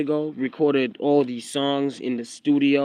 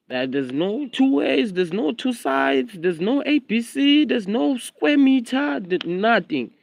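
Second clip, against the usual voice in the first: much higher, slow and emphatic; worked-up insistence; 9 semitones higher, slower than usual. Real, not acted. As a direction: hammer home that there is no other way. Insisting to critics, voice climbing.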